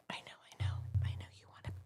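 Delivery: quick hushed whisper to the pet. Real, not acted. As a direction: whisper it fast and breathy.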